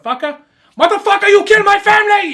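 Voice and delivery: high, loud rising fury; mock outraged yell. Chicken seller screaming back at the soldier, high loud fury. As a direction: build from a startled echo to a yell.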